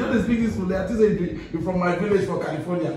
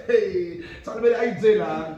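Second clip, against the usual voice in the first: normal talking voice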